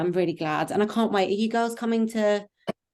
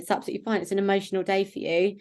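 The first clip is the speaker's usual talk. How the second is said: ordinary talk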